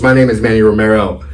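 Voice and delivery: ordinary talk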